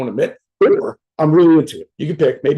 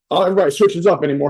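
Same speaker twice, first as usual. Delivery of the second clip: normal talking voice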